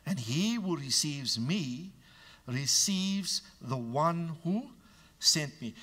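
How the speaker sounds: slow and weighty; quoting Jesus solemnly; slow scripture reading. Acted. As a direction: read it slowly with gravity. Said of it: "quoting Jesus".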